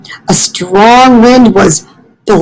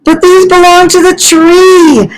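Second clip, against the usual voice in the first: much higher, insistent protest; protesting Fletcher; 9 semitones higher than usual. Acted. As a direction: tell the porcupine the leaves are the tree's. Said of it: "said Fletcher".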